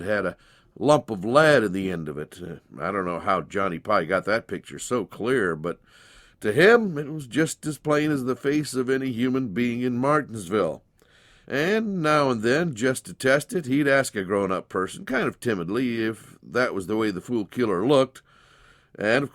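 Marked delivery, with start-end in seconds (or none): none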